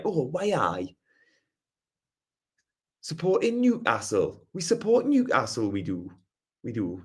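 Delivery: put-on Newcastle lilt; Geordie accent. Shown as a demonstration. Streamer demonstrating Newcastle supporters' talk, Geordie accent. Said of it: "people warm to the Geordie accent".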